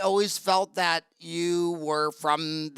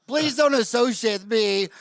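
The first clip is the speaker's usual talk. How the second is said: laughing, slow mock plea, louder; pleading through a laugh; 3 semitones higher, 5 dB louder, slower than usual. Dentist begging foreign colleagues, laughing, mock plea. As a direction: beg with a laugh not to be lumped in. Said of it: "They're like".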